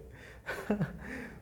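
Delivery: delighted laugh. Real, not acted.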